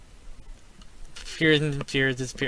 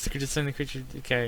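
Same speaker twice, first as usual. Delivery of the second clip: ordinary talk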